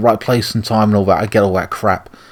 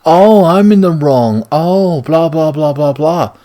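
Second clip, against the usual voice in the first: sarcastic mockery; 5 semitones higher, slower than usual. Real, not acted. Mocking the character's scolding.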